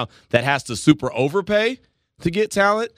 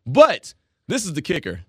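ordinary talk, nothing marked

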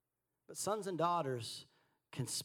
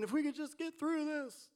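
much higher, mock-frantic plea in the storm; panicked disciples; 12 semitones higher than usual. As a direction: play a frightened disciple begging to get through.

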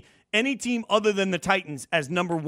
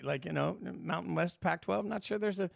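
usual speaking voice

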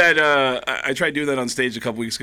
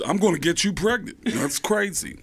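higher, off-kilter comedian impression; Tracy Morgan; 5 semitones higher than usual. Comic mimicking an interview guest, celebrity impression. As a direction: imitate a wild comedian's interview patter. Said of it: "It's like Tracy Morgan in every".

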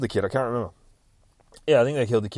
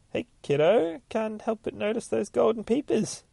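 high-pitched acted-out dad; daddy voice; 11 semitones higher than usual. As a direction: put on a high, chirpy daddy voice. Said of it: "And Daddy says".

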